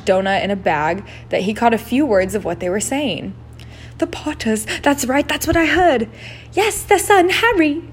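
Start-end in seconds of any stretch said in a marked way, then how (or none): 4.0-7.8 high, excited hushed gossip; whispering stranger. A narrator's reading. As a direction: gossip about the Potters, high. Overheard whisperers in cloaks.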